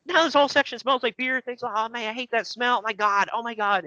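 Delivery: high-pitched whiny disgust; whiny friend. Retold or imitated. Mocking Greg's fuss about spilled beer, high whiny disgust. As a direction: squeal it high like a fussy friend who hates the smell. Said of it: "He was like, he was like".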